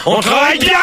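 high-pitched scolding yell; exasperated shout. Real, not acted. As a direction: shout the order at a slacking colleague. Host repeats it, shouting.

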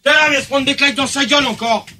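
high-pitched, exasperated rant; angry outburst. Real, not acted. Host fuming at the one cutting callers off, angry. Host rants at being cut off.

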